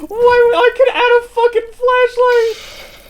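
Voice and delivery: high, slow disbelieving squeal; incredulous. Real, not acted. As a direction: stretch it out in disbelief.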